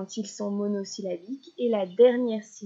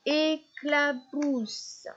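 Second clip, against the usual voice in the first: "éclabousse" very slowly, higher, in three beats; syllable by syllable; 5 semitones higher, much slower than usual. Shown as a demonstration. Teacher counting three syllables for a child, "éclabousse" slowly split. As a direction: separate "éclabousse" into é-cla-bousse.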